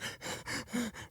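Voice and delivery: high, quiet sobbing gasps; mock hysterical sob-breaths; imitated crying gasps. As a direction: mimic gulping breaths of frantic crying. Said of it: "crying like crazy, crying like".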